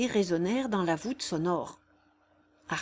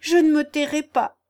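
loud and higher, defiant; defiant outcry; 7 semitones higher, 8 dB louder than usual. Acted. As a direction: shout her defiance at him. Jeanne defies gunman.

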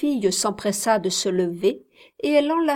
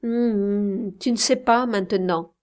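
usual speaking voice

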